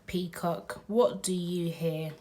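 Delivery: ordinary delivery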